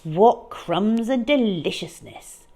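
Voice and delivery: usual speaking voice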